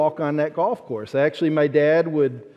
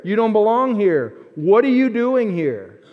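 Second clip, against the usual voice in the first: higher and louder, accusing; hostile insider; 5 semitones higher, 3 dB louder than usual. Retold or imitated. Church insiders rejecting a newcomer, higher and louder. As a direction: push the outsider away.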